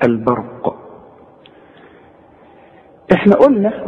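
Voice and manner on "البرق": البرق with a light ب and a heavy ر. Shown as a demonstration. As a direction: say البرق, with the ر full. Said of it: "الباء والراء هي المفخمة".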